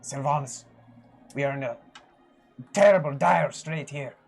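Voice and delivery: kneeling cleric's rising plea; desperate prayer. Acted. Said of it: "kinda gonna go to one knee and just be like".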